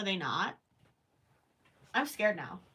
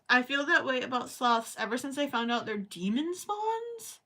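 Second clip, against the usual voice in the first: ordinary talk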